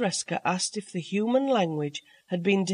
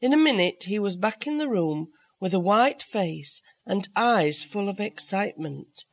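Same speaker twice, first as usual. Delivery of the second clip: plain reading voice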